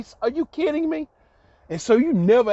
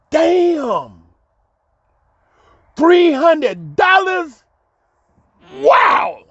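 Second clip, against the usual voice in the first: amazed; 9 semitones higher, 5 dB louder, much slower than usual. Retold or imitated. Reliving the amazement.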